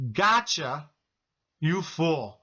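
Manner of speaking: taunting demon, lightly voiced; imitating a mocking demon. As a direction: gloat like a demon over its catch, pausing between phrases. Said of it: "The voice of a demon said to me".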